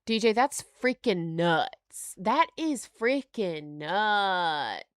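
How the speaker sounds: slow, drawn-out amazement; real slow stunned awe. Real, not acted. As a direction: stretch it out in disbelief.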